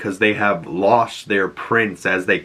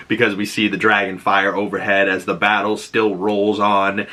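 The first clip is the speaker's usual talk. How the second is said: ordinary delivery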